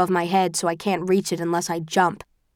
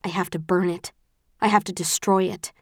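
usual speaking voice

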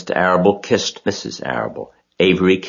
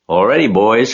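higher, louder call; rally the helpers with a shout; 6 semitones higher, 5 dB louder than usual. A narrator's reading. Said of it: "cried Mister Zuckerman".

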